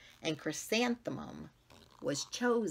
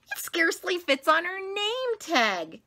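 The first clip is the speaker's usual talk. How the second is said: much higher, louder snooty jab; snooty girl; 13 semitones higher, 6 dB louder than usual. Acted. Rita pointing at the name tag, high and snooty. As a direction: point and sneer. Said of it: "said Rita".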